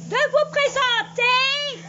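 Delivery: plus fort, plus aigu et lent; répète-le en haussant fort la voix; répété en criant. Acted. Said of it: "pourquoi elle crie ainsi?". (cri aigu et lent)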